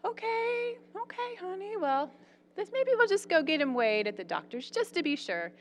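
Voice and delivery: lightly mimicked mother calming her child; soothing mom. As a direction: humor the daughter gently as her mom. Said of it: "And she's like".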